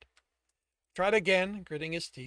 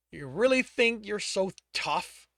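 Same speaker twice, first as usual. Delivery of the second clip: angry taunt; 5 semitones higher, 9 dB louder than usual. Acted. Torturer seething.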